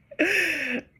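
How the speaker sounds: a burst of high laughter at herself; quick embarrassed giggle; short high laugh. Real, not acted. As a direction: laugh it off, amused at yourself. Laughing at own pettiness.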